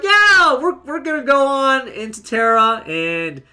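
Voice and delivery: a big high, loud "Yeah" that drops back to normal; mock cheer. The gang cheering about conquering Terra, loud mock cheer. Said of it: "the gang is like".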